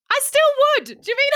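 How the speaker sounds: comically shameless high-pitched outburst; defiant mock confession. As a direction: blurt it out high and loud. Comic confession after imagined mum's revelation.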